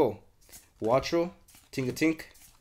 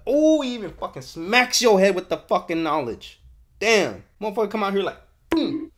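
loud, higher, hyped-up yelling; hyped shout; 8 semitones higher, 7 dB louder than usual. Real, not acted. Opening packs on stream, hyped shouting about the card art. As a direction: shout it out, blown away by the card.

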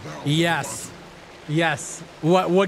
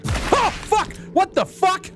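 sudden startled outburst, pitched way up; 14 semitones higher, slower than usual. Real, not acted. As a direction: jump out of your skin mid-game. Sudden attack in the game.

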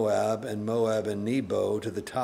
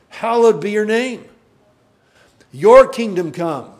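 preach; 6 semitones higher, 11 dB louder than usual. Real, not acted. Preaching builds to prayer.

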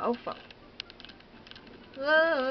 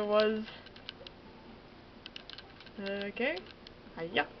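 ordinary delivery

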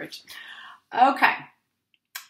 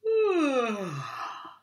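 big yawning sigh; 5 semitones higher than usual. Pretend. Hippo wakes with big yawn.

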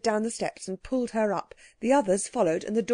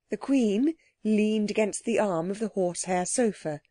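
plain reading voice

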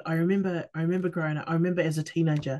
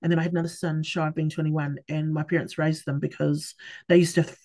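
usual speaking voice

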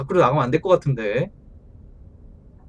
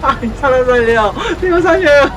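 crying for help; 7 semitones higher, 4 dB louder than usual. Real, not acted. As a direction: wail for help. Mock plea for help.

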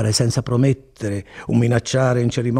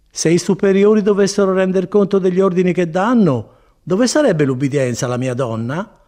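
a hint of a chiding friar, raised then settling; lightly acted friar explaining obedience; lightly voiced friar, reasoning; 7 semitones higher, 4 dB louder than usual. A narrator's reading. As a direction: gently lecture her as the friar.

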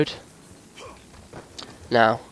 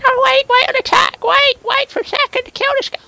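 silly squeaky voice; 4 dB louder than usual. Pretend. Silly voice asking enemy wait.

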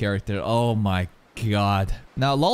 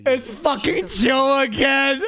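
excited shout; 7 semitones higher, slower than usual. Real, not acted. Recognizes returning enemy.

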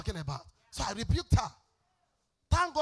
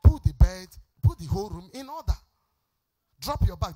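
mock stern order; 4 semitones lower, 6 dB louder than usual. Mother ordering children to clean.

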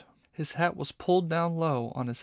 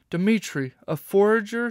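higher and louder, rising in disbelief; a horrified shriek of discovery; shocked shriek; 7 semitones higher, 6 dB louder than usual. A narrator's reading. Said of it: "she shrieked".

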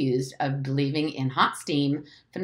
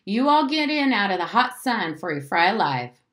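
yelled summons; 6 semitones higher, 4 dB louder than usual. A narrator's reading. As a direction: call everyone in from the doorway. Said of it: "appeared in the front door and yelled".

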